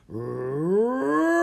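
imitated elephant cry, growing as it goes; elephant's rumbling roar. A narrator's reading. Elephant hears a distant call, swelling roar. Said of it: "rumbling cries grew louder and louder".

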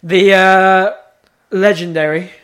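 big mock fanfare for a podcast segment; mock grand intro. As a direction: trumpet the segment like a showman. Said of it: "I wouldn't say legendary".

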